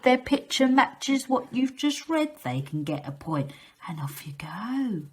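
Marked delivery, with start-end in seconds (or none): 3.9-5.0 soft whispered send-off. Acted. Teacher whispering a secret start cue to children.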